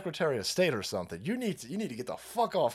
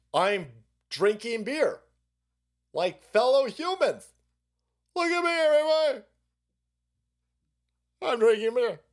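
high, stilted show-off voice, getting louder; mock try-hard politician; 6 semitones higher, 4 dB louder than usual. Host mocking candidate posing with beer, exaggerated awkward boasting. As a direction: loudly announce each bit like a fake human.

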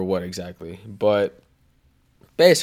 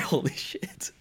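awed whisper; 3 semitones higher, 8 dB quieter, slower than usual. Retold or imitated. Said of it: "and I was like".

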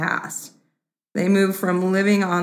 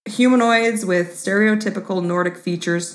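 ordinary talk

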